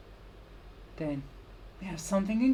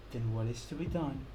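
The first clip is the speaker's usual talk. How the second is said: usual speaking voice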